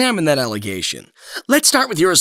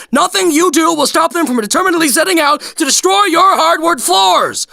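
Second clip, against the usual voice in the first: higher and louder, building exasperation; exasperated rant; 9 semitones higher, 5 dB louder than usual. Acted. Foster parent venting about kids spilling, rising exasperated outburst. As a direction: let the parent frustration boil over.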